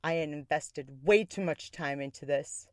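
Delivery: ordinary talk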